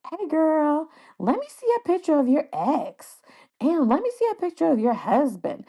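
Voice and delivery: high, playful gossipy voice; nosy registration lady. Retold or imitated. Registration lady teasing the nurse, high playful gossip.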